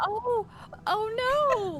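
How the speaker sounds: high-pitched, drawn-out cutesy oh no; mock dismay. Player mimicking cat bapping a severed arm, mock dismay.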